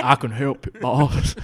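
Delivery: loud, laughing gentle giant; a hint of a slow gentle giant; Green Mile voice. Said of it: "that guy from the Green Mile".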